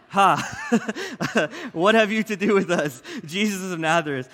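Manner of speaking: half-laughing demon voice. Acted. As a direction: try a demon voice through giggles. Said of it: "I was gonna do a demon voice".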